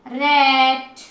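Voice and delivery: रैट with a long level "ai"; long sound. Shown as a demonstration. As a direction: draw out रैट before the T. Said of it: "long sound में दे रही हूँ". (रैट में "ऐ" लंबा खींचें)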